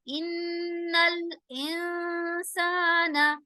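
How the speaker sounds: इन्नल with a long humming nasal न्न; इन्नल इंसाना chanted slowly with nasal ghunna; ghunna on noon. Shown as a demonstration. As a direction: hum the न्न through the nose, sing it slowly. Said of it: "नून पर गुन्ना होगा".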